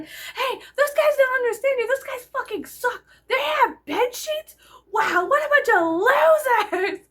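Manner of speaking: high, loud laughing taunts; mock shouting. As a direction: jeer at the other protesters. Shouting to other side.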